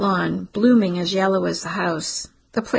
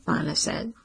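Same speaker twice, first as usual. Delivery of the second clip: ordinary delivery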